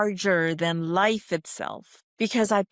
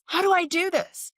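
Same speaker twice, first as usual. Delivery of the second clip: high-pitched voice of the fretting mind; mock-anxious inner voice, pitched way up; monkey mind; 11 semitones higher than usual. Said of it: "The monkey mind is what".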